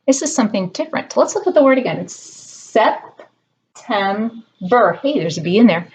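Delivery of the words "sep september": September split slowly into Sep-tem-ber; syllable by syllable. Shown as a demonstration. Teacher to kids, sounding out the syllables slowly. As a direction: break September into its three beats. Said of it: "clap your hands for each part".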